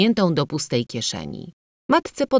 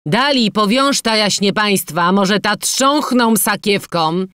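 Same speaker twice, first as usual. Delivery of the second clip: loud, higher-pitched taunt; malicious jeer; 7 semitones higher, 6 dB louder than usual. A narrator's reading. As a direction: call to the crowd, mocking the newcomers. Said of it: "pochwalił tę złośliwość". (głośna, złośliwa kpina)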